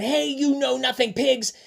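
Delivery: high storybook yell. Acted. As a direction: call it out high at the pigs. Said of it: "and yelled".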